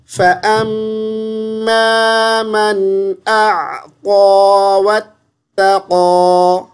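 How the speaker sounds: slow melodic tilawat chant; Quran recitation. Shown as a demonstration. Teacher recites the verse for the student, slow tilawat. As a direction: recite it slowly, drawing out each vowel.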